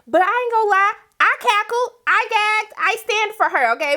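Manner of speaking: high, bright delight; high excited fangirling; excited gushing. Real, not acted. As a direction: gush over her boldness. Gushing over the rude boss.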